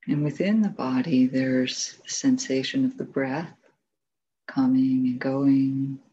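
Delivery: unhurried meditation guidance, long silences between phrases; guided meditation. Real, not acted. Teacher guiding sitters to notice sound, silence and body, spacious meditation voice. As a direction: lead them gently, phrase by phrase, leaving room to rest.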